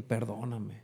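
hushed tearful "Perdóname"; tearful plea. Retold or imitated. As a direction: say "Perdóname" quietly as if near tears. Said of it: "Empecé a llorar así unas lágrimas y dije".

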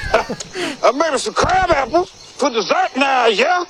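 high, slow hammed-up Southern accent; mock Southern drawl. Mocking how Southerners talk, hammed-up Southern accent. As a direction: overdo a Southern cook voice. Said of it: "That's how we all talk".

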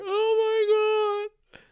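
high, loud, stretched-out sobbing wail; mock blubbering. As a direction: wail along, hammed-up and drawn out. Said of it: "and I'm over here".

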